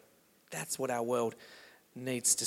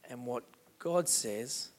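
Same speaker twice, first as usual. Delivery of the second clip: soft, tender address; 2 semitones lower, 3 dB quieter than usual. Acted. Pastor speaking tenderly to a hurting listener, softly. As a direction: speak gently to someone hurting.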